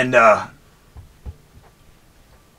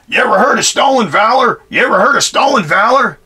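high, loud mocking yell of the kid; 9 semitones higher, 4 dB louder than usual. Ex-Marine imitating the kid at the gas pump, high mocking yell. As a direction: shriek the accusation like a self-righteous kid. Kid yelling after him.

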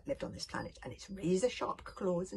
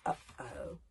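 dismayed uh-oh; 6 semitones lower, 6 dB quieter than usual. Shown as a demonstration. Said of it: "There you can see Hiccup saying".

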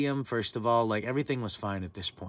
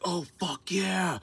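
high, drawn-out cry of celebration; elated cheer; 9 semitones higher, much slower than usual. Retold or imitated. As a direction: relive the big moment, stretched and high. Retold celebration of the save.

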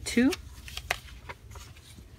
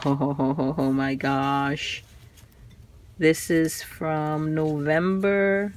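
ordinary talk, nothing marked

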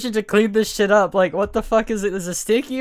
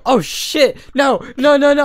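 louder, higher mock panic; retold freak-out; 6 semitones higher, 5 dB louder than usual. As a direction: act out a friend panicking over a spill. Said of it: "he's, like, freaking the fuck out".

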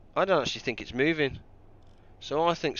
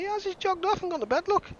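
much higher, teasing falsetto; mock squeaky voice. Gamer mocking his dozing crewman in falsetto.

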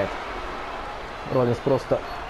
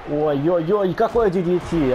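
excited shout; 6 semitones higher than usual. Real, not acted. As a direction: cry out at the big hit. Big move called live. (восторженный выкрик)

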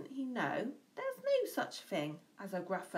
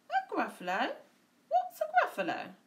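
high, quick sly fox; 11 semitones higher, 4 dB louder, faster than usual. A narrator's reading. Fox asking the mouse about the Gruffalo, high and quick. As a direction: squeak it out as the startled fox. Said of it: "Fox said".

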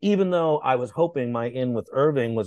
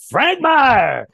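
loud excited call of a name; a rock star shouting across the room, high and loud; 13 semitones higher, 9 dB louder than usual. Retold or imitated. Dave spots an old friend, loud high call.

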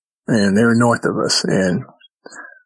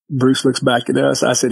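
normal talking voice

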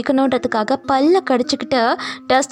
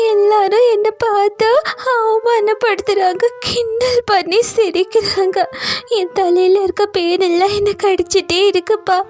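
tearful crying; 8 semitones higher than usual. A narrator's reading. Veena telling father about being bullied, crying. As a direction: plead through sobs, voice pitched high. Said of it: "அழுதுகிட்டே அப்பா கிட்ட சொன்னா வீணா".

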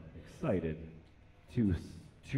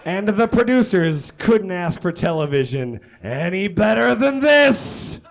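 loud; 4 semitones higher, 9 dB louder than usual. Acted. Comic reading, big finish.

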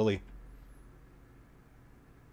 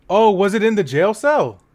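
much higher, louder incredulous yelp; hammed-up amazement; mock surprise; 10 semitones higher, 9 dB louder than usual. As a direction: overplay the shock for laughs. Mock surprised question to character.

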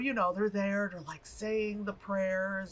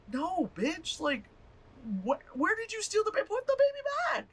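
mock outraged husband. Husband voiced, angry at wife.

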